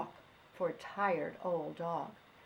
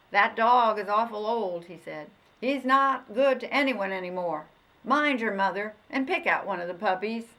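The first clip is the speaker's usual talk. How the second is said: louder, kindly stern dog catcher; dog catcher advising; 2 semitones higher, 8 dB louder than usual. Acted. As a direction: talk sense into the boy.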